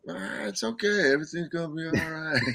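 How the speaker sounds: lightly voiced laid-back Dave; reassuring Dave. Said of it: "He'd be going".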